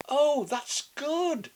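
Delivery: put-on high, slow, sweet child; drawn out like a helpful little girl. A narrator's reading. Girl answering grandma, slow sweet child voice.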